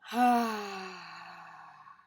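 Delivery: loud, long exhale out of the mouth; big breathy sigh, slowly fading out; audible sigh. Shown as a demonstration. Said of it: "exhaling out of the mouth with an audible sigh".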